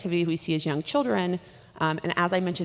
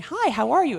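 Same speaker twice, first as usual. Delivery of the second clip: imitating a gushing, higher and louder hello; overfamiliar child greeting; 8 semitones higher, 6 dB louder than usual. Child greeting a stranger up in their face, overly familiar. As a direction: play an overly friendly kid greeting a stranger. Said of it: "almost overly familiar with strangers".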